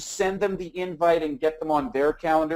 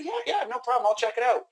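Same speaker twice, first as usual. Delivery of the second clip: higher-pitched mimicry of an easy yes; lightly acted, quick-to-agree customer; agreeable prospect; 7 semitones higher than usual. Warm-market prospect agreeing to help, lightly acted in a higher voice.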